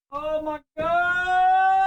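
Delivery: high, long-held wail; exasperated wail. Real, not acted. Narrator despairing at flubbed takes, drawn-out wail. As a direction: stretch the last word out high.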